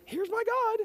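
mocking a god made to order; mock squeaky God. Preacher mocks a custom god, squeaky high.

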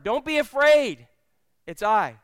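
loud, high, slow proclamation; proclaiming. Acted. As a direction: ring it out slow over the storm. Preacher repeats Jesus' words.

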